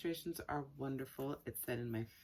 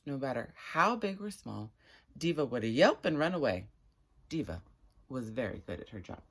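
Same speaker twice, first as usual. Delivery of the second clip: lively, louder read-aloud storytelling; story narration; 7 dB louder than usual. Acted. Reading a chapter to viewers at home, animated storytelling. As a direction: read it like a picture book to an eager audience.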